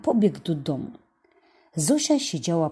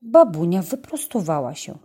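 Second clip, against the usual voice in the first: usual speaking voice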